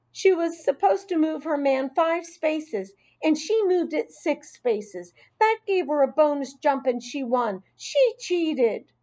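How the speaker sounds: high-pitched, aggrieved little voice; high, hurt accusation; upset child tattling. A narrator's reading. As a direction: complain to the teacher about your friend. Tearful child tells on friend.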